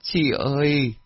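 high, drawn-out mournful lament; tearful wailing. Acted. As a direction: wail out a mother's grief. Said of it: "Tiên khóc sướt mướt".

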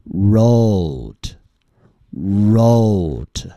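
"wrote" very slow, the O stretched long; drawn-out practice word. Shown as a demonstration. Teacher modelling practice words for learners, drawn-out pronunciation. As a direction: hold the rounded O glide in "wrote". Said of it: "我们来练习几个单词".

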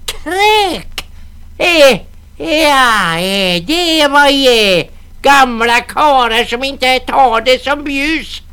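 high, slow grating crone; screeching old woman. Acted. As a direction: screech back at them. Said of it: "gnissla gumman".

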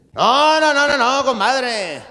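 high, loud repeated no; loud protest. Acted. Compadre stopping his friend, high loud protest. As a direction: wave him off hard.

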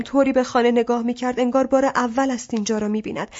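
ordinary delivery

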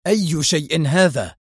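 slow, mildly heated question; a touch of narrated irritation; sharp demand. A narrator's reading. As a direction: demand it, drawing the words out. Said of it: "صاح به جراهام في حدة".

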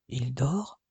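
softly, not to wake him; low hushed voice by the bed; hushed question. Acted. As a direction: whisper it over the sleeper. Whispered question by sleeping brother.

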